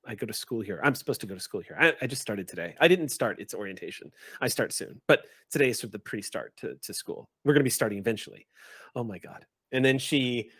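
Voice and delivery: usual speaking voice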